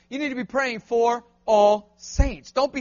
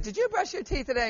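1 lightly voiced mother; 3 semitones higher, 6 dB quieter than usual. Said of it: "your mother said".

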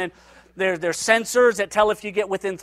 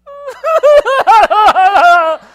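loud, high fake bawling; mock crying wail; 12 semitones higher, 12 dB louder than usual. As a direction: burst into a hammed-up wail. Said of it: "she starts crying right there".

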